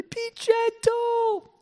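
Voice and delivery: put-on very high, very slow scared little girl; daughter's plea. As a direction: stretch it out like a frightened child begging. Daughter pleading during splinter removal.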